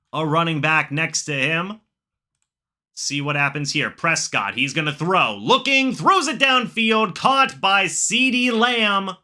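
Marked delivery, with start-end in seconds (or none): 5.4-9.1 higher, louder excited kickoff call; play-by-play call. Acted. Streamer calling the kickoff for his chat, excited play-by-play. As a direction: call the opening kickoff like a broadcaster.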